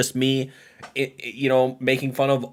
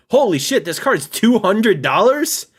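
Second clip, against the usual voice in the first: loud, high disbelief at the price; shocked; 9 semitones higher, 5 dB louder than usual. Real, not acted. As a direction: gasp out the price in real shock. Reacting to card price.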